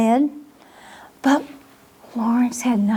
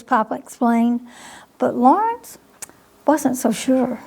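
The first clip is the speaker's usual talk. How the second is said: ordinary delivery, nothing marked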